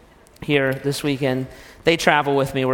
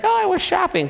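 much higher breezy wife; wife's voice. Acted. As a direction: airily answer in falsetto. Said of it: "And she's like".

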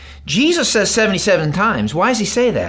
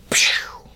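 unvoiced whoosh of a hard yank; 4 dB quieter than usual. Shown as a demonstration. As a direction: blow out a big swinging turn. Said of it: "just like that".

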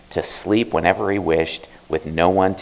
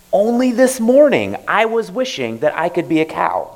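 put-on higher youthful boy voice; boy's voice; 11 semitones higher, 3 dB louder than usual. Bae telling the old man his wish, higher boy voice. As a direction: tell it like a sulky boy. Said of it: "tell the old man his story".

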